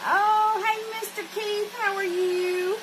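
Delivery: high, loud call-out to a friend; light up and sing out hey; excited greeting. Real, not acted. Calling hello to Mister Keith in chat, excited and high.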